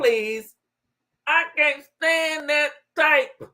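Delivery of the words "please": ordinary talk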